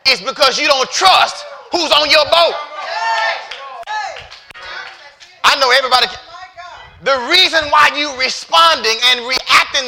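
loud, high-pitched sermon shout; full-force preaching. Real, not acted. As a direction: drive it home to the congregation at the top of your voice.

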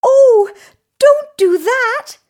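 very high, loud tiny goat plea; small creature pleading at high pitch; little goat. A narrator's reading. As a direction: cry out in a squeaky-high goat voice. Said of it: "said the little Billy Goat Gruff".

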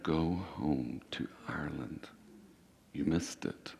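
a soft, sly voiceless whisper; tempting whisper. Retold or imitated. The enemy tempting the pastor to go home, a sly whisper. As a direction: breathe it like a lie in the ear. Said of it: "he would say things like".

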